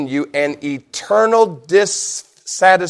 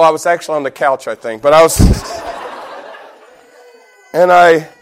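ordinary talk

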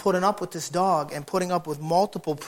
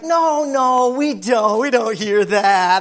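loud denial; 4 semitones higher, 8 dB louder, much slower than usual. Retold or imitated. Neighbors denying, arguing back.

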